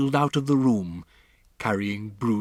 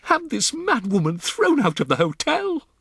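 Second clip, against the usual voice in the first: much higher, louder imperious wife; haughty order; 6 dB louder than usual. Acted. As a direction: snap an order to her husband. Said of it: "Missus Jenkins said to her husband".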